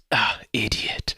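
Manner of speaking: slow breathy whisper of contempt; whispered insult. Retold or imitated. Quoting the villain at the statue, slow whisper. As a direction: hiss it slowly under your breath. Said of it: "he goes".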